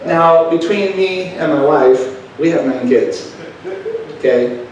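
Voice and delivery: normal talking voice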